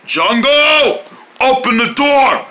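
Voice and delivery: drawn-out demand at a door; insistent slow holler; calling out. Acted. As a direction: call through the door, stretching the words. Shouts at the locked door.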